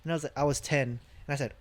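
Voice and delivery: ordinary talk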